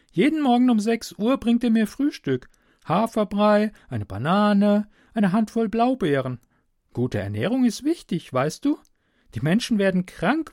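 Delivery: put-on high, girlish voice; captive girl, matter-of-fact. Girl offers stranger coffee through the wall, high and matter-of-fact. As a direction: speak as an unbothered young girl, pitched high.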